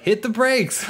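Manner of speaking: high exasperated yell at the screen; exasperated shout. Retold or imitated. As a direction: yell advice at a dumb movie hero. Speaker yelling advice at movie.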